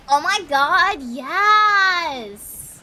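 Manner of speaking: loud, eager yes; excited. Acted. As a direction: shout it with delight. Excited reply to Starbucks offer.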